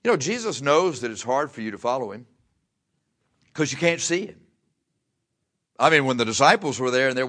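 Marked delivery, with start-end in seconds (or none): none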